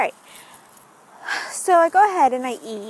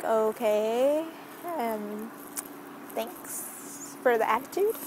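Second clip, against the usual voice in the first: sarcastic thanks; 10 dB quieter, slower than usual. Retold or imitated. Customer answering a manager's attitude, quietly sarcastic. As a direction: drag it out quietly, unimpressed.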